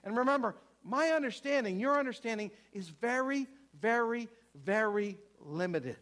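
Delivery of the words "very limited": ordinary delivery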